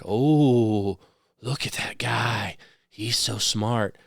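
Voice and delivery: slow, awed mock gushing at a genius; mocking gullible fans. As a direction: play the gullible fan, lightly mocking. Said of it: "They're like".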